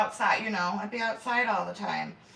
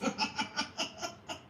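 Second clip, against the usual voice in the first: airy unvoiced giggle; breathless laugh; 7 semitones higher, 7 dB quieter than usual. Real, not acted. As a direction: let a breathy laugh slip out. Laughs at own remark.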